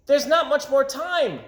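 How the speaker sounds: put-on high, urgent child voice; a small boy worried about the sunset; little boy's voice. A narrator's reading. Little boy warning Mike Mulligan, high and urgent. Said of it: "said the little boy".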